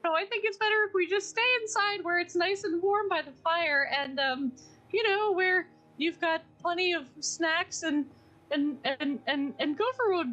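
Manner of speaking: high, stammering little character voice; anxious Piglet. Acted. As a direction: nervously talk everyone into staying put. Plays anxious Piglet reassuring self.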